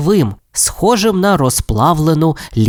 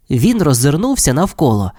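plain reading voice

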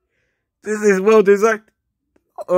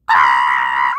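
startled scream. Real, not acted. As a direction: scream out at an awful sight. Recoils from a disliked image.

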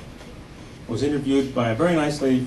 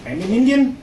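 put-on Indian accent; 9 semitones higher, 4 dB louder than usual. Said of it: "When I have a computer problem".

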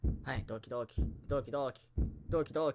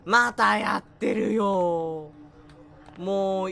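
very slow, louder fed-up drawl; exasperated; 8 dB louder, much slower than usual. Real, not acted. Player sick of the enemy's repeated trick, fed-up drawl. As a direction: stretch every word, completely fed up.

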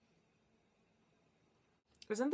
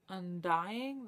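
ordinary talk

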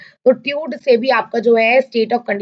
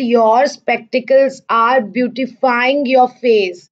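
slow dictation; much slower than usual. Shown as a demonstration. Teacher dictating example sentence.

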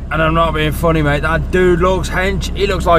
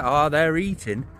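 normal talking voice

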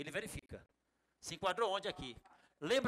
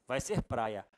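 ordinary talk

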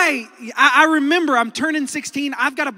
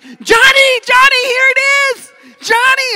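very high, sustained yell; mom calling out; 13 semitones higher, 12 dB louder than usual. Retold or imitated. Mom waving by the car to her son, loud calling out. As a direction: holler the name across a parking lot.

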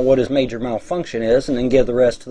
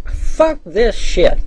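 fed-up curse; 9 semitones higher, 5 dB louder, much slower than usual. Retold or imitated. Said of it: "I'm like".

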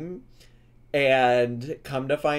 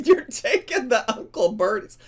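high, laughing echo of slang; tickled, laughing disbelief; real amused laughter; 8 semitones higher, 3 dB louder than usual. Real, not acted. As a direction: repeat it through giggles, pitched up. Laughing at baffling slang in clip.

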